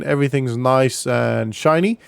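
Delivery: ordinary talk, nothing marked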